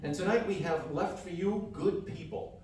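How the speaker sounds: ordinary delivery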